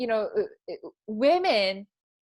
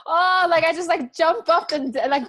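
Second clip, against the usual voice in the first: excited; 5 semitones higher, 5 dB louder than usual. Real, not acted. Excited retelling of reaction.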